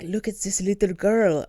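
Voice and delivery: a hint of a bad Russian accent; lightly faked Russian old man; mock Russian accent, barely there. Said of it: "not a Russian accent".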